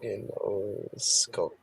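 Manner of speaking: Ginosko said slowly, syllable by syllable; slow careful Ginosko. Shown as a demonstration. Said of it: "I pronounced it".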